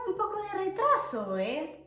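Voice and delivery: very high prim chiding; French host chiding. Retold or imitated. French host chiding a late guest, very high prim voice. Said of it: "te dicen".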